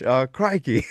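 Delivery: botched British that comes out Australian; mock Australian crikey. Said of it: "say something British".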